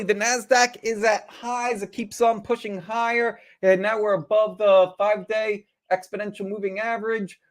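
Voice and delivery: lightly mocking nervous trader; anxious investor. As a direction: voice the jittery trader who feels left behind. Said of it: "lot of people are asking themselves".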